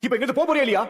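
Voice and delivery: loud, furious demand to leave; angry shout. Acted. Husband orders her out, shouting furiously. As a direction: yell her out of the room. Husband drives unwanted woman out.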